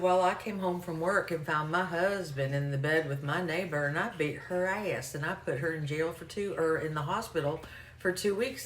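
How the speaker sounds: imitated Arkansas storytelling caller; heavy Arkansas accent. Caller recounting why she lost her license, Arkansas accent. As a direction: tell it in a thick Arkansas accent. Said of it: "just really heavy accent for me".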